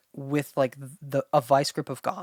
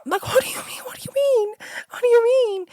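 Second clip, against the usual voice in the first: high, disbelieving squeak rising into a loud cry; can't believe what people are saying; high incredulous what-do-you-mean; incredulous squeal; 5 dB louder than usual. Real, not acted. Incredulous at online opinions.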